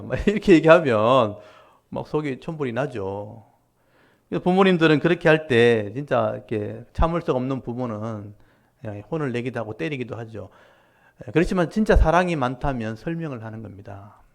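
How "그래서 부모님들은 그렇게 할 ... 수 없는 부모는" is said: usual speaking voice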